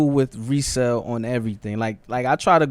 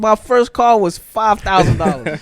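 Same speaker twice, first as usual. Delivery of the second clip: high, loud, amused outcry; loud disbelief; 8 semitones higher, 8 dB louder than usual. Real, not acted. Reacting to a friend's six-thousand-dollar shoes, loudly incredulous. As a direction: exclaim it louder and higher, astounded. Astonished at the shoe price.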